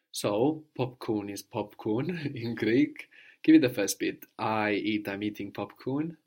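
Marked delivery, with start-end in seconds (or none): none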